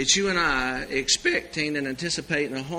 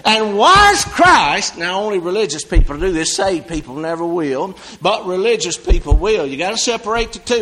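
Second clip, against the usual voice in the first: usual speaking voice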